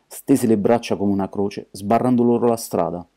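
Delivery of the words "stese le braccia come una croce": usual speaking voice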